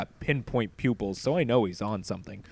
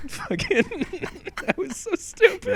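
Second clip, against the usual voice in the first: high, breathless laughter; cracking up at the bit; laughing through the words; laughing hard. Real, not acted.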